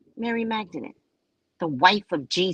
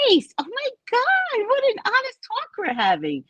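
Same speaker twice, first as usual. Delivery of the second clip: gushing mock delight. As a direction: gush over the honest talk.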